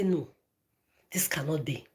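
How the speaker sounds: quiet disbelief. Retold or imitated. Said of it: "I'll say".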